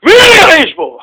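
sudden high outburst, dropping away; stormy father. Acted. Father woken from nap storms out, angry outburst. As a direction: burst out at whoever is there. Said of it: "האבא יוצא בסערת רוחו".

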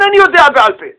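mock the excuse scornfully, loud and high; mocking quote. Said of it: "ותמחקו לכם מהראש". (ציטוט לועג ורם של התירוץ)

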